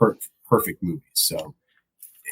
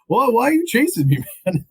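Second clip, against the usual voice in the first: high-pitched imitation of a baffled runner; comically high, bewildered question; mock bewildered quote; 12 semitones higher than usual. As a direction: play a puzzled guy being chased, pitched up high. Acts out baffled man being chased.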